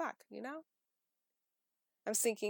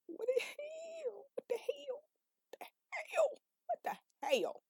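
exasperated squeal. Real, not acted. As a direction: squeal it out in disbelief. Exaggerated exasperation at misreading.

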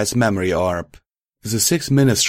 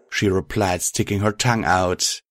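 usual speaking voice

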